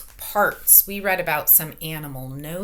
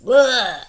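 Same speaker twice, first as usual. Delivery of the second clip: loud grossed-out ugh; disgusted ugh; 2 semitones higher, 7 dB louder than usual. Acted. Teacher reacting to a gross lizard fact, loud disgusted ugh. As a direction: recoil at something gross.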